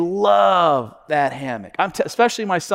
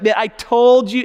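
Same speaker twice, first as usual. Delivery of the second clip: louder pretend I-told-you-so; mock scolding; 4 semitones higher, 6 dB louder than usual. Father to his injured son, mock scolding. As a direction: play the unsympathetic parent rubbing it in.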